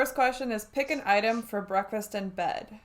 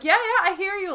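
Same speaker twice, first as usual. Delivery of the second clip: high, loud, over-eager assistant; high-pitched eager-to-please yapping; eager dog; 10 semitones higher, 6 dB louder than usual. Retold or imitated. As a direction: pipe up like an excitable dog. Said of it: "like a dog".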